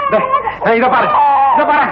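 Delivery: put-on high coaxing calls to the baby; coaxing a crying baby. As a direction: plead with the wailing baby to look. (அழும் குழந்தையை உயர்ந்த குரலில் சமாதானம்)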